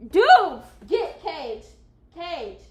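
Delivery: loud, high outcry at the dog; shocked outcry. Real, not acted. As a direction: yell out in disgust. Reacting to a dog's smell.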